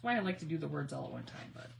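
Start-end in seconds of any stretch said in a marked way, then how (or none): none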